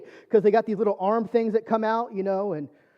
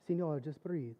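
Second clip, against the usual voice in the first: put-on soft Spanglish-accented reassurance; hushed, soothing voice with a Spanglish accent; 3 semitones lower, 11 dB quieter than usual. Anesthesiologist calming patient in Mexico, gentle Spanglish accent. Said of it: "in his kind of Spanglish accent".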